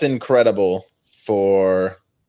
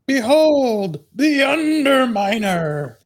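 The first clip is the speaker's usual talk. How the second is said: much higher, slow, grand villain proclamation; much slower than usual. Acted. Contestant hamming the villain Underminer, high and slow.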